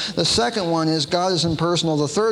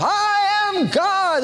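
high, slow drawn-out proclamation; mock Shirley MacLaine; much slower than usual. Preacher mocking Shirley MacLaine on the seashore, high and drawn out. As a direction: ham up her grand declaration. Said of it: "trying to convince herself".

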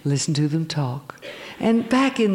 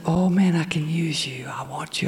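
soft whisper, much softer; whispered call of God; 3 dB quieter than usual. Retold or imitated. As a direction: breathe it gently, like a secret calling. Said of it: "another voice saying".

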